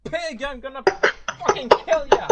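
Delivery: high, laughing mock rage at a buddy knocked out; furious thug. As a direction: screech it like an enraged punk, half-laughing. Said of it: "the Mohawk guy goes like".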